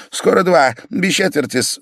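high, brisk answer; eager reply. A narrator's reading. Pavel telling the patient the time, eager. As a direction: answer promptly and keenly.

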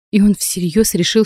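ordinary delivery, nothing marked

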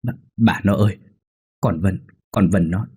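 halting, repeated words, mildly shaken; narrated stammer with broken starts; stammering shock. A narrator's reading. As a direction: stammer out bad news, understated. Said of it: "miệng ông lắp bắp".

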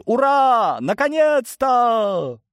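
high, loud, stretched-out cheer; rejoicing. A narrator's reading. Bodyguards cheer their young master, rejoicing. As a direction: cheer with long jubilant vowels. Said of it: "возрадовались охранники".

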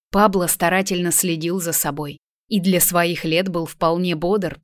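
ordinary delivery, nothing marked

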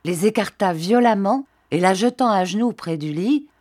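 plain reading voice

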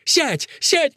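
urgent high yelp; Fusia. A narrator's reading. Fusia orders Akelina to sit, crying out high. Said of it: "кричит Фусия".

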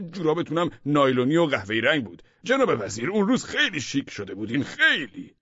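high, loud, gushing flattery of the boss; excited recollection. A narrator's reading. (یادآوری پرهیجان و چاپلوسانه با صدای زیر و بلند)

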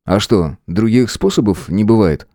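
plain reading voice

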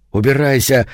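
usual speaking voice

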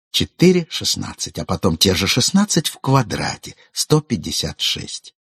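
ordinary delivery, nothing marked

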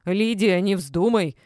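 loud, urgent cry; shouted warning. A narrator's reading. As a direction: shout to stop her. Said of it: "закричала Рима Марковна". (крикнуть предостерегающе)

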